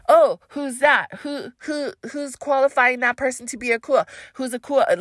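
high, insistent mimicry of offended busybodies; mock indignant questioning. Mimics people sending backlash messages.

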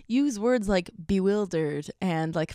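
ordinary talk, nothing marked

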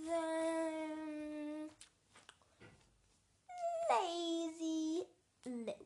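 slow, stretched announcement of the name; drawn-out title. A narrator's reading. Storyteller announces the chapter slowly, stretched out. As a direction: draw out the title like a showman.

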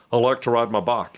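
"ride" and "bike" with flat Texas vowels; Texas accent. Retold or imitated. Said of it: "deeply committed Texas accent".

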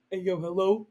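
put-on girl faking a guy's voice on the phone; fake man voice. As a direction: put on a mock dude voice, answering his phone. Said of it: "pretending to be him".

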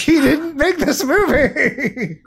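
laughing through the words; real laughter breaking in; can barely get it out for laughing. Real, not acted. Laughing at Warhol's claim.

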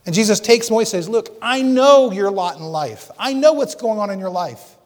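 fervent pulpit climax; preaching at full force. Acted. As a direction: lift the congregation, voice soaring. Preacher builds again.

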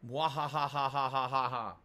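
slow evil cackle; mad scientist laugh. Pretend. C M Fool is evil.

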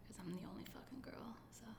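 whispered aside. Real, not acted. Confessional aside about being the girl.

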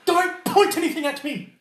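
high, loud, whiny noble giving in; snooty surrender. Acted. The noble gives in to the intimidation, whining high and loud. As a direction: give up with a disdainful whine and a shaky laugh. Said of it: "he's like".

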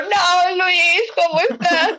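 high, drawn-out tearful wail; exaggerated sobbing plea, words stretched out; mock crying. Begging Luis who left the message on seen, mock crying. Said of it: "yo llorando".

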